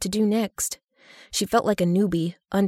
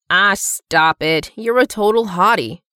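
louder, drawn-out friendly protest; playful protest; 6 dB louder, slower than usual. A narrator's reading. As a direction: brush off the self-pity emphatically. Friend reassures and flatters her.

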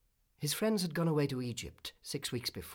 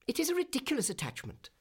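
very high, fast bird chatter; twittering swallows; 13 semitones higher, faster than usual. A narrator's reading. Swallows mocking their friend's love, in high quick twitters. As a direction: twitter it like gossiping birds. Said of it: "twittered the other swallows".

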